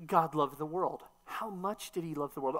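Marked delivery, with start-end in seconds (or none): none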